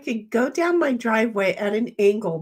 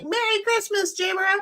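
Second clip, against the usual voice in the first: quoting Linda; 9 semitones higher than usual. Retold or imitated. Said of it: "And Linda's like".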